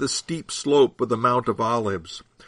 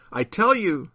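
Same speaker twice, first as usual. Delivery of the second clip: a mildly raised, higher answer; calling back; 6 semitones higher than usual. A narrator's reading. Jesus answering his critic, lightly called back. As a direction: call back firmly without shouting. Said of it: "Jesus called back".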